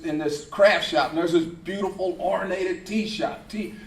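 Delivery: usual speaking voice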